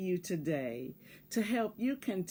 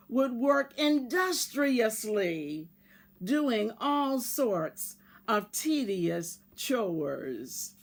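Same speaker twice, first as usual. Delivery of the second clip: lively read-aloud voice, bigger than her chat; storybook narration; 3 semitones higher, 4 dB louder than usual. Acted. Teacher reading a picture book to her class, expressive storytelling. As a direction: tell it like a bedtime story to children.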